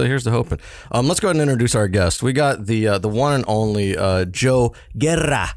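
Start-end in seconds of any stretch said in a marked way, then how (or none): 4.0-5.5 Guerra said slowly with a trilled R; rolled r. Shown as a demonstration. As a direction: roll the R in Guerra, Spanish style. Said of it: "Rolled the R's".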